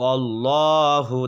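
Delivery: অল্লাহু with a full, thick লাম; heavy lam in Allahu. Shown as a demonstration. Teacher demonstrating the heavy lam. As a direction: round and darken the লাম of অল্লাহু slowly. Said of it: "লামটাকে আমি পুর করবো".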